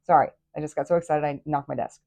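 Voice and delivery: ordinary delivery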